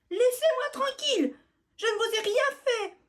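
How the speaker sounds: very high, loud desperate plea; pleading. Acted. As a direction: beg them with all she has. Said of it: "supplie Biscotte".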